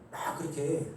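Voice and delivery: ordinary talk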